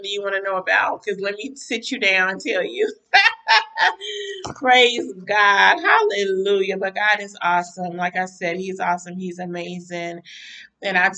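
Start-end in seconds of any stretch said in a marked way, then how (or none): 4.6-6.8 loud joyful shout of praise; real worship shout. Real, not acted. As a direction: call it out to the heavens.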